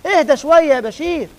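high, loud order to calm down; preacher loudly urging a restless worshipper to calm down; loud soothing command. Acted. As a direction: tell Bashir firmly to settle in the mosque. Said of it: "نقول للموتور ده".

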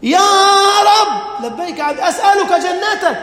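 high, loud pleading cry to God; pleading servant. Acted. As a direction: beg like a desperate servant. Preacher acts out dialogue with God.

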